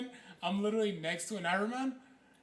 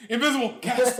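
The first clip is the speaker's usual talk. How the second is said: excited outburst; 4 semitones higher, 10 dB louder than usual. Retold or imitated. Casting the spell at last.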